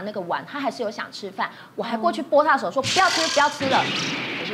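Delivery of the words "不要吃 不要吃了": angry refusal. Retold or imitated. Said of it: "然后我非常生气".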